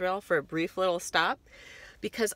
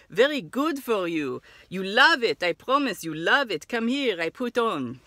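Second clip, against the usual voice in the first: put-on Israeli accent; 3 semitones higher, 4 dB louder, slower than usual. Kiosk seller pitching Dead Sea cream.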